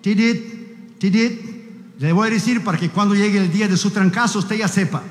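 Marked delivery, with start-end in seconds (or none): 0.0-2.0 steady beeping, tidit, tidit; monitor beep imitation. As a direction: mimic a heart monitor's even beep. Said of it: "el monitor, en cuanto hace tidit".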